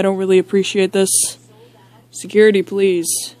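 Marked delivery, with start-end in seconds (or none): none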